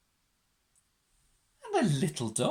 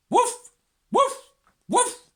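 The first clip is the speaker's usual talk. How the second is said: high, loud, sharp woofs; dog barking; 8 dB louder, slower than usual. A narrator's reading. Dogs join Mog's noise, high loud barking. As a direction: bark each word like an excited dog. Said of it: "the dogs began to bark".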